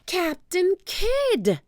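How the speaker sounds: put-on high, slow young girl's voice; a kid sounding out the name; child's voice. Annie reads the name aloud, high and slow.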